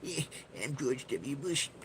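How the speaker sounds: slow, high goblin-like voice; George W Bush goblin voice. Retold or imitated. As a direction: croak it like a cowboy goblin. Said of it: "I make him sound like a goblin".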